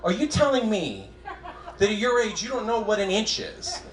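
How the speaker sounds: high, pleading disbelief, half laughing; a hurt kid appealing to his mom; incredulous kid voice. Retold or imitated. Son baffled at his mother, half laughing and pleading. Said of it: "And I was like".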